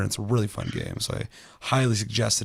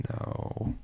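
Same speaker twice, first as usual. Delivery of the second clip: flat whispered no; 8 dB quieter than usual. Retold or imitated. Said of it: "I was like".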